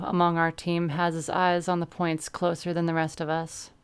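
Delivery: usual speaking voice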